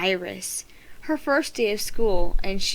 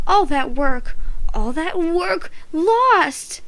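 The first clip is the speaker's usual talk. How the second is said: angry wail; 7 semitones higher, 3 dB louder, much slower than usual. A narrator's reading. Tasha mourning lost work.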